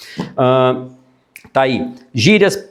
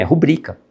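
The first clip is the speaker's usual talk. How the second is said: "rubrica" with stress on BRI; tônica correta em rubrica. Shown as a demonstration. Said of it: "É rubrica que se fala". ("rubrica" com a tônica no meio)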